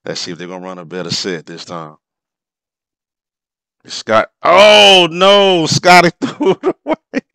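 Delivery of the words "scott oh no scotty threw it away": high, loud laughing disbelief; excited outburst. Real, not acted. Fan reacting to Pippen's turnover, high laughing yell. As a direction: yelp it out as the ball goes away. Pippen throws the ball away.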